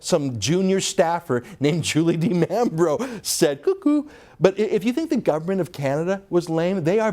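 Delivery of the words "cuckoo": very high sing-song cuckoo call; mocking cuckoo. Real, not acted. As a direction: chirp it like a cuckoo clock to mock. Mocking the staffer's statement as crazy.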